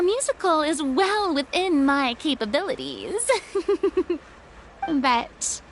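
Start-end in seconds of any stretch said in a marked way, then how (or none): 3.4-4.2 a short, higher self-satisfied chuckle; proud little laugh. Acted. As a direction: laugh lightly at your own past glory. Boasting of her stage past.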